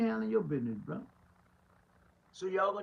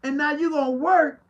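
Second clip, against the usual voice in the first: higher and louder, incredulous; heated preaching; 8 semitones higher, 8 dB louder than usual. Acted. Pastor calling out politicians who want votes, higher and louder. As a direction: let the outrage climb.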